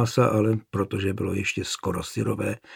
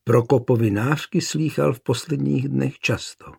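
plain reading voice

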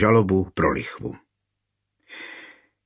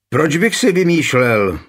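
higher-pitched, mildly indignant protest; offended cry; 6 semitones higher, 4 dB louder than usual. A narrator's reading. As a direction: protest as the wronged son, voice lifted. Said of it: "křičel uraženě syn".

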